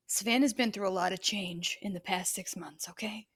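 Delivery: confiding stage whisper to the gang. Real, not acted. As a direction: lean in and whisper the secret. Confiding aside to audience.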